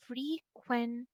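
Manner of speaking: "frequency" split as fre, quen, cy; soft "frequency" with a pause between syllables. Shown as a demonstration. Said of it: "the syllabication of frequency".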